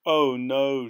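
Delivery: loud moan of dismay. A narrator's reading. Dorothy facing the huge ravine, moaning. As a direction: groan it out in despair. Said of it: "she moaned".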